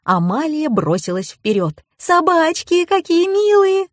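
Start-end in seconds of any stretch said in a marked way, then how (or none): 2.0-3.9 put-on very high-pitched girlish gushing; high, drawn-out squeal of delight. A narrator's reading. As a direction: coo over cute dogs in a high voice. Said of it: "пришла в восторг Амалия".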